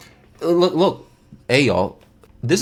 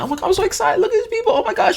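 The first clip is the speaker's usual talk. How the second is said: mock squealing girlfriend, much higher; high giddy imitation of her excitement; excited; faster than usual. Girlfriend gushing at the theater, squealing high.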